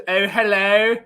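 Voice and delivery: plummy posh English hello, played for laughs; mock posh accent. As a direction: ham up an upper-class English accent. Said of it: "you just got out posh".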